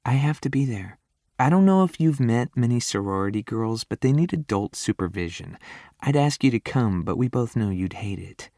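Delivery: a hint of a sorority girl; lightly voiced young woman. A narrator's reading. Heroine explaining lightly.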